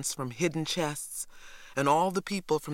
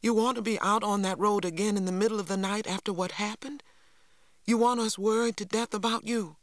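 higher and fast, rising alarm; pleading sharply, higher and higher; 6 semitones higher than usual. Acted. Mother afraid for her husband, sharp and rising. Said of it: "Mama's voice rose sharply".

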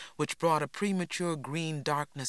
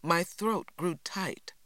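usual speaking voice

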